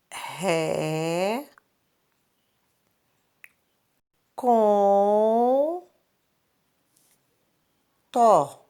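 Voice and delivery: "Ré, com, tó" drawn out with long pauses between syllables. Shown as a demonstration. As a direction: stretch each syllable of "Ré, com, tó" as if writing it. Teacher sounds out word while writing.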